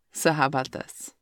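ordinary talk

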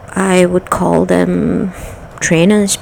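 normal talking voice

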